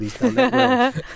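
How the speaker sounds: very high, drawn-out mock gush; gushing club director. Ex-coach imitating a Bradford director's gushing praise. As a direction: stretch "that" in an over-the-top squeal.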